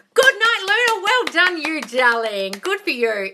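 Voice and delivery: gushing praise. Real, not acted. Cooing praise to a viewer's comment. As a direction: coo it up high.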